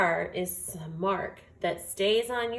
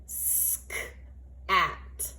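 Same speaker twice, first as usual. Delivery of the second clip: whispered word; 4 dB quieter than usual. Shown as a demonstration. Teacher whispers the word to hear the next sound. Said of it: "The next sound I hear is".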